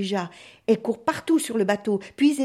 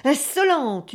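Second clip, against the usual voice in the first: raised cry of outrage; furious yell; 5 semitones higher, 4 dB louder, slower than usual. A narrator's reading. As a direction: hurl the insult at her. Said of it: "hurle le marin".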